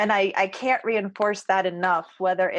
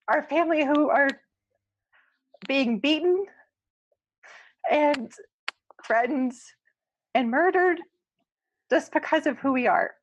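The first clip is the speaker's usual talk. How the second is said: choked up; 5 semitones higher than usual. Real, not acted. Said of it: "I'm sorry for making you guys cry".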